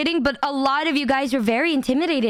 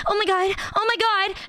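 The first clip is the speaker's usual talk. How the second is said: higher, mock-excited oh my god; imitating a freaking-out fan; 5 semitones higher than usual. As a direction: play the starstruck fan losing it, lightly. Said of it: "she'd just be like".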